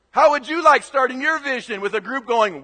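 usual speaking voice

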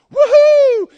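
loud, high ecstatic whoop; joyful whoop. Acted. Optimist son finding his gift, whooping with joy. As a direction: let out a wild cheer of delight. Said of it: "he springs up and he goes".

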